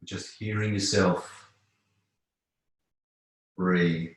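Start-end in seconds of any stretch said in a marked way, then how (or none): none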